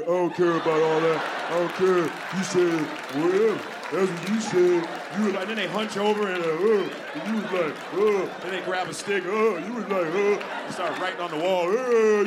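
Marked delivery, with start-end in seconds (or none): 0.0-4.9 lightly voiced dumb caveman; mock a grunting caveman man. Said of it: "same caveman voice". 7.9-8.3 caveman grunt. Retold or imitated. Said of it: "same caveman voice". 9.2-9.6 caveman grunt. Retold or imitated. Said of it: "same caveman voice". 10.1-10.4 caveman grunt. Retold or imitated. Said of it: "same caveman voice". 11.7-12.2 caveman grunt. Retold or imitated. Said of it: "same caveman voice".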